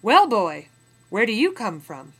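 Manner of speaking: a loud, rough trader's question; gruff trader. Acted. Trader sizing up a captive, calling out loud and gruff. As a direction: bark it down at the boy.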